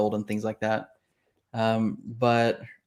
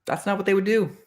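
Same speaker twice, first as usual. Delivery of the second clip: inner objection; 8 semitones higher than usual. Retold or imitated. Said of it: "and I'm like".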